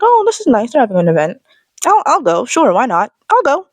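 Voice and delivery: high, bright mock-casual shopper voice; cheerful shopper. Regular shoppers spotting the Marshalls event, high cheerful chirp. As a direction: chirp it like a happy passer-by. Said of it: "they're like".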